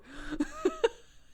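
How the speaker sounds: high laughter; real laugh, up high. Real, not acted. Mother laughs.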